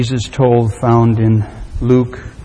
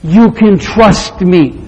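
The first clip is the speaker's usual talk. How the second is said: slow, raised, emphatic call; insistent urging; 7 semitones higher, 6 dB louder, slower than usual. Acted. Daddy urging his trapped son to jump, insistent call. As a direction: stress each word slowly and firmly.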